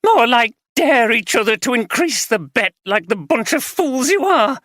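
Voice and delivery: wife shouting in contempt; contemptuous shout. Acted. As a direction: sneer it at him. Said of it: "she shouted in contempt".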